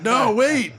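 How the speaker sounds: high-pitched mock alarm; confused protest. Friend stopping a stranger from coming over, confused protest. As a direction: cut in to stop the caller, baffled.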